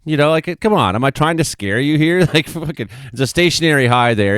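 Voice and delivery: ordinary talk, nothing marked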